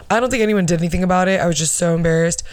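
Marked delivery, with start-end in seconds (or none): none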